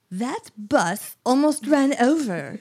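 slow, hammed-up weird British-Italian accent; put-on soap opera accent. Old lady reading her soap opera monologue, put-on accent. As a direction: draw it out in a proper mock-British soap accent. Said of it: "I'm doing a really good accent".

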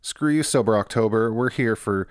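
upbeat host reading a sponsor spot. Acted. Host pitches the sponsor to listeners, upbeat ad read. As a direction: sell the beer like a podcast ad.

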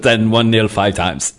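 slow put-on German accent; drawn-out German manager impression. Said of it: "I'll get into character".

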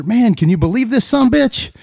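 a touch of eager boasting; mildly excited. Acted. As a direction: show off a catch with mild excitement. Boys show off their catch.

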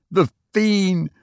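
a touch of terror; mild shriek. A narrator's reading. Student in frenzy before officers, mock shriek. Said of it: "shrieked he".

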